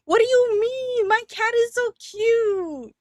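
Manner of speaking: high, slow doting voice; mock offended cat lover. Defending her cat, mock offended.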